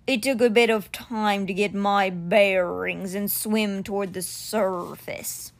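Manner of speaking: very slow, stretched-out big cat voice; stone cheetah, recollecting. Acted. Stone cheetah tells the boy of waking underwater, slow creature voice. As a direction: drag each word out ponderously.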